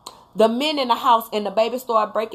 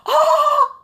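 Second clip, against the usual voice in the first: startled laughing yelp; 5 dB louder than usual. Retold or imitated. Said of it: "'cause I went from".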